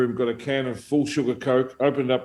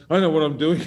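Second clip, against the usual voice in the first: laughing; 4 semitones higher than usual. Retold or imitated. Retold boast, breaks into laughter.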